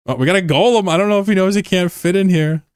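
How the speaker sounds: high, loud excited call; excited shout. Real, not acted. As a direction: yell it out as the golem shows up. Spotting a golem mid-fight.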